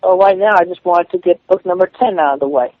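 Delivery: ordinary talk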